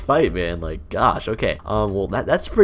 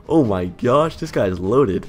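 normal talking voice

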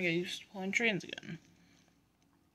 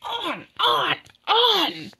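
very high, loud cries; goading shout; 12 semitones higher, 9 dB louder, much slower than usual. Acted. Trucks shoving Oliver on and on, shouting high.

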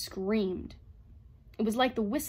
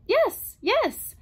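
high, loud agitated outburst; distressed robot, voice pitched high; anguished cry; 10 dB louder than usual. A narrator's reading. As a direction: cry out as if cornered. Cornered robot under relentless pressure.

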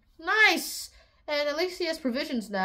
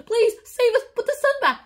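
mock begging; 8 semitones higher, 4 dB louder than usual. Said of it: "the Incans are all like".

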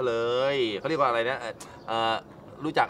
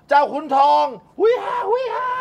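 high, loud whoop; a loud high hooting cry; whooping call; 14 semitones higher, 5 dB louder than usual. Acted. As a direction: shout it out like a cheer. Calling out a cheer.

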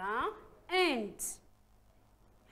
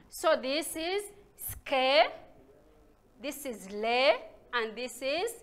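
slow drill; 9 dB louder than usual. Shown as a demonstration. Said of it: "slow syllable drill".

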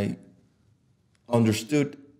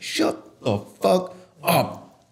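very slow, higher, word-by-word command; acted anger; 6 semitones higher, much slower than usual. Showing how to silence advice-givers, slow emphatic anger. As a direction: punch out each word angrily, spaced apart. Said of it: "yeah, you get angry".

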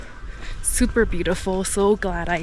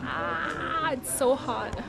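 burnt fingertips; 7 semitones higher, slower than usual. Real, not acted. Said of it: "it's too hot for me".

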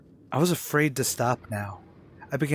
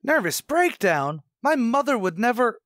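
shocked disbelief; 10 semitones higher, 4 dB louder than usual. Acted. Son hearing of his mother's breakdown, high shocked protest. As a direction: refuse to believe it.

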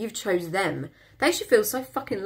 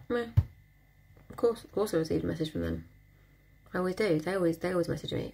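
softer, offhand shrug of a voice; unbothered; 5 dB quieter than usual. Retold or imitated. Confident woman shrugging off her crush's text, softer and offhand. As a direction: toss it off like it's nothing. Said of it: "normal, like".

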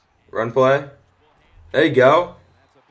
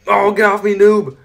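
annoyed outburst; 8 semitones higher, 6 dB louder than usual. Real, not acted. Blocked by opponent in game.

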